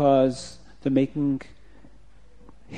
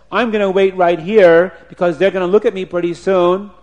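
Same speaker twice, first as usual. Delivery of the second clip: louder, slightly higher, in usual diction; officer's upset insistence; 5 semitones higher, 10 dB louder than usual. Acted. Slighted officer resolving to wait for the children, upset. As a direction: announce it indignantly as the officer. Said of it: "he got very upset".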